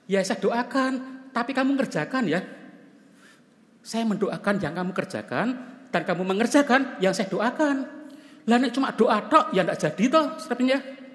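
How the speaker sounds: high, loud, insistent counsel; firm pastor. Retold or imitated. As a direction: push the student to do the work himself. Pastor firmly tells student to work. (pendeta menegur tegas dengan suara tinggi dan keras)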